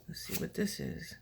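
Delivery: whispered aside to herself; hushed voiceless murmur. Real, not acted. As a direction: breathe it out, voiceless and close. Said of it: "whispered aside to herself".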